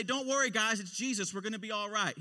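loud, high reassurance to the group; calling out. Acted. Peter to his friends in the storm, calling out.

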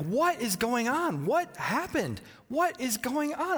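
rising, slow, anxious what-is-going-on; a parent hearing screams, half panicked; alarmed parent. Retold or imitated. Dad hearing kids scream, asking in alarm. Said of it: "and I was like".

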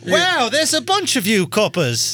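high, slow mock British exclamation; put-on British accent. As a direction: marvel at the police in a hammed-up British accent. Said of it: "He won't stop that fucking accent".

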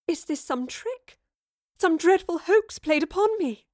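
high-pitched, bewildered questioning; distressed disbelief. A narrator's reading. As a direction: demand answers in rising alarm. Shocked Jane faces her double.

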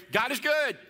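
high, loud call for a response; call to congregation. Shown as a demonstration. Pastor calling out to the congregation, waiting for their reply. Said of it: "Are you ready?".

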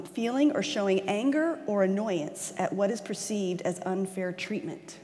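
ordinary delivery

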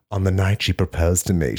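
normal talking voice

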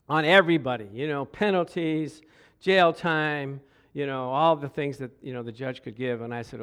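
usual speaking voice

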